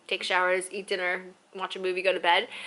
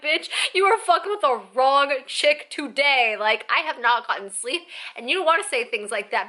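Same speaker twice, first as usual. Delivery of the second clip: high, loud indignant warning; bitchy; 5 semitones higher, 5 dB louder than usual. Retold or imitated. Cranky passenger telling off the joking stewardess, bitchy. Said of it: "such in a bitchy ass mood".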